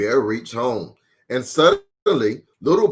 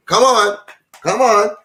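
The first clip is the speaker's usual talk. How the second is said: playful urging; 7 semitones higher, 3 dB louder, faster than usual. Real, not acted. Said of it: "urging child to answer".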